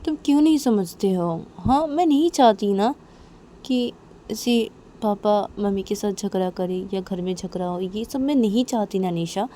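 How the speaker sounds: exasperated confrontation. Acted. Manisha confronting Nisha about the family fights, exasperated. Acted quarrel, pleading to be understood.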